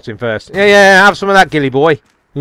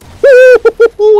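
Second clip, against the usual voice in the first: loud, high hooting laugh; gleeful whooping laugh; 10 dB louder than usual. Real, not acted. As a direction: hoot with glee over the kill. Gloating over killing ghillie boy.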